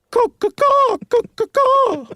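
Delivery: very high, loud cawing; crow caws. Acted. Meagle jeering at Barnes by the door, high crow caws.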